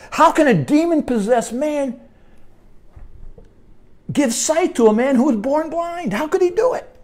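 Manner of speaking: preacher stretching up in disbelief; rising preacher's plea. Acted. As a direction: build the question into an incredulous cry. Rhetorical preaching climax.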